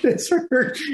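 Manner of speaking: mock pained squeak. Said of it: "Yeah, I went".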